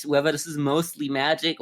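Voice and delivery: ordinary talk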